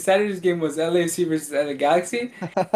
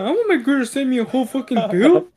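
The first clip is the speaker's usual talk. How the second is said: high-pitched comic griping; mock outrage; 6 semitones higher than usual. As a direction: imitate an indignant friend, breaking into laughter after. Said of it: "Sergio was fucking mad".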